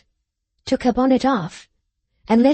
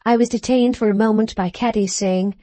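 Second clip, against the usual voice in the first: usual speaking voice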